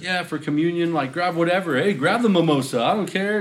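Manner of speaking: careless mock pastor. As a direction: toss it off like nothing matters. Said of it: "somebody else was like".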